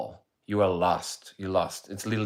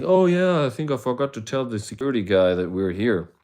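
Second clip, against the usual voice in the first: casual Todd; 4 semitones higher, 4 dB louder than usual. Retold or imitated.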